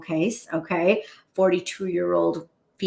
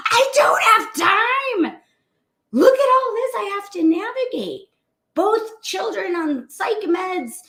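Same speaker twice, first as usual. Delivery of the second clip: overwhelmed voice; 10 semitones higher than usual. Retold or imitated. Cardiologist voices busy physician sisters' frantic excuses, high and strained. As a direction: voice the frazzled doctor listing burdens.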